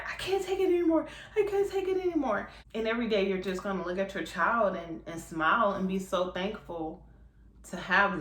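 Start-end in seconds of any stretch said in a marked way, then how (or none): 0.1-2.5 high, fast, half-cried wail; mock crying. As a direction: whine it like a mom at her wits' end. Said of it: "you're freaking crying and you're just like".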